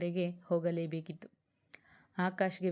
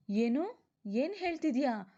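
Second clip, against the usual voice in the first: higher-pitched, startled questions; shocked disbelief; 6 semitones higher than usual. A narrator's reading. Friend just told of the death, shocked disbelief. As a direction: react in shock, pitch leaping up.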